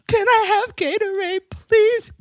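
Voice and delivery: high-pitched, childlike mock pleading; woozy grateful pleading. Daughter acting out asking mom for Gatorade, woozily pleading. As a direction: imitate a loopy patient begging sweetly. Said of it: "so grateful".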